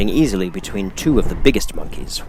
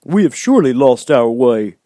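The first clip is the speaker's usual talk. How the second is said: louder, higher scarecrow, complaining; grumbling scarecrow; 5 semitones higher, 6 dB louder than usual. A narrator's reading. Scarecrow complaining to friends, loud grumbling voice. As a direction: grumble it out as a fretful straw man. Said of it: "began to grumble a bit".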